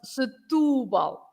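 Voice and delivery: "Setúbal" slow, U stressed; slow Portuguese Setúbal. Shown as a demonstration. Guest repeats the name slowly for the host. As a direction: spell out "Setúbal" carefully.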